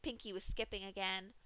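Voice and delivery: plain reading voice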